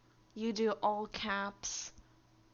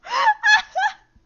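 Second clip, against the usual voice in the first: shrieking laugh; 18 dB louder than usual. Real, not acted. Bot keeps timing him out.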